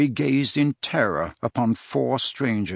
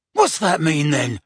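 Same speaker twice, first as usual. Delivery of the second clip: yell; 4 semitones higher, 4 dB louder than usual. A narrator's reading. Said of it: "yelled the Death Eater".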